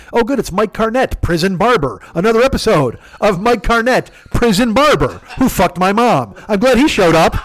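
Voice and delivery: high-pitched mock TV announcement; sarcastic welcome. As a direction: announce him like a dreaded sitcom episode. Sarcastic kid greeting mom's lover.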